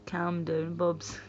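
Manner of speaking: normal talking voice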